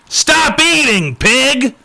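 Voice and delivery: high, slow, exaggerated sneer; hammed-up mocking shout. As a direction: stretch it out like a cartoon bully. Mocking the hateful message.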